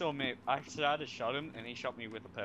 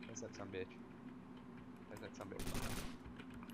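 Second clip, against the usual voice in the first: hushed whisper; 5 semitones lower, 11 dB quieter, slower than usual. Real, not acted. Hunting enemy in game.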